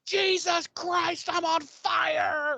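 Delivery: hammed-up howl of a burning man, dragged out high; agonized scream. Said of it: "where he just is like".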